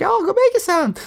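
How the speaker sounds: put-on high, sweet old grandma voice; granny snapping out of a trance, high and sweet. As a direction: chirp it like a doting granny. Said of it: "And she's like".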